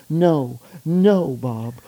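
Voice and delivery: lightly voiced girlfriend pleading; girl voice. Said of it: "I like your girl voice".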